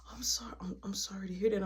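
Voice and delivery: soft unvoiced sorry to hear that; whispered condolence. Awkward teen whispering condolences to grieving girl. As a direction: breathe it out gently.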